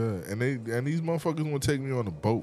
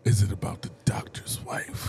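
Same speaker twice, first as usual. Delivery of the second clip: whispered. Real, not acted. Mom joined, whispering question.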